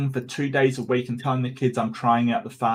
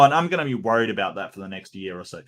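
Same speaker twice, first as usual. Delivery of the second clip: ordinary talk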